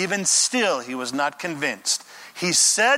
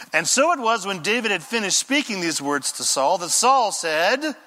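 usual speaking voice

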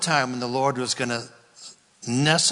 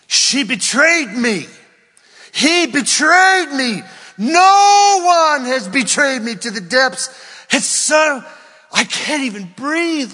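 much higher and louder, slow, wailing; betrayed spouse wailing to the Lord; anguished crying shout; 12 semitones higher, 9 dB louder than usual. Acted. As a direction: cry out in betrayal. Betrayed spouse cries to God.

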